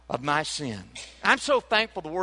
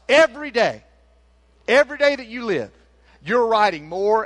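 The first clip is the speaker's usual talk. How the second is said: preaching at full force; 6 semitones higher, 6 dB louder than usual. Acted. Preacher surges again.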